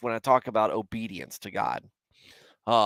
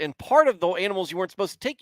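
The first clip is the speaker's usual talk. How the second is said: normal talking voice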